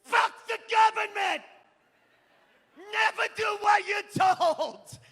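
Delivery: high, loud hardcore scream, slow at end; punk shout. Retold or imitated. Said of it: "songs I wrote that were like".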